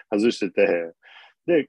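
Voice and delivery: normal talking voice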